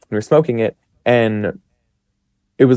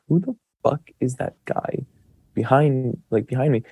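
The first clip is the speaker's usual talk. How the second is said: hushed alarm; 8 dB quieter than usual. Retold or imitated. Startled by a stranger in the mirror.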